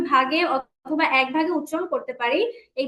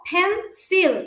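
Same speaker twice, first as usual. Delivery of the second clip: "ফেন্সিল" in two separate beats, ফেন, then সিল; split into syllables; 2 semitones higher, much slower than usual. Shown as a demonstration. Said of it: "দুইবার উচ্চারণ করতে হচ্ছে". ("ফেন্সিল" দুই ভাগে ভেঙে উচ্চারণ)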